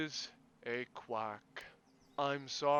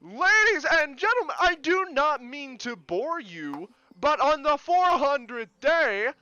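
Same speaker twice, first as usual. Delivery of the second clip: orate like a self-important mayor; pompous mayor; 9 semitones higher, 9 dB louder than usual. Acted. Mayor gives pompous speech.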